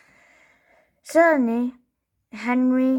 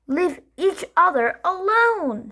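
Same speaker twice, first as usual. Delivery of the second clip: sharp, high mom telling them off; stern mom voice; 8 semitones higher than usual. A narrator's reading. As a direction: scold both kids at once. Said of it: "said Mom".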